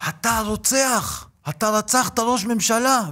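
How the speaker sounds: high, slow mock scream of accusation; a man yelling at the speaker in a supermarket, screaming; retold screaming. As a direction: shout it like an angry stranger in the supermarket. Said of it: "וצורח וצורח".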